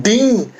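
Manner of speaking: high, drawn-out ding like a bell; imitated bell ding, pitched well up; ding cue. Shown as a demonstration. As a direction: sing out a chime to signal a pause. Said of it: "I'll say something like".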